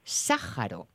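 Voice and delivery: Sájaro with the stress on the first syllable, SÁ-ja-ro. Shown as a demonstration. Host repeats the listener's word, stressing the first syllable. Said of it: "Con tilde en la primera".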